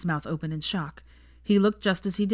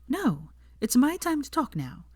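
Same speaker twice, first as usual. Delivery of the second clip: higher, firm female voice; Hermione's voice; 8 semitones higher than usual. Acted. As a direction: cut him off, gently firm.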